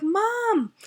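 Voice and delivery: put-on high-pitched kid's call for mom; child calling Mom.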